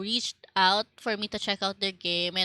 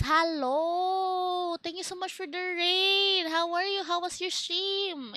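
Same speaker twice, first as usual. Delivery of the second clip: excited greeting; 8 semitones higher, slower than usual. Real, not acted. Thanking a raider.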